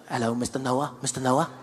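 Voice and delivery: put-on lightly accented nervous purser; hint of a South African purser; flight attendant. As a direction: fuss over the celebrity. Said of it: "he's like".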